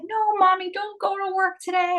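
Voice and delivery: put-on high, whiny little kid voice; little son pleading. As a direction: beg like a small boy clinging to mommy. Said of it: "He's like".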